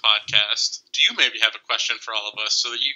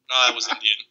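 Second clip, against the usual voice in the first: usual speaking voice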